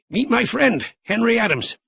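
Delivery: hint of a spry old man; lightly voiced old Gramp. A narrator's reading. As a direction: play the feisty grandfather. Gramp's voice in the story.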